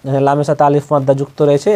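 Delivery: ordinary delivery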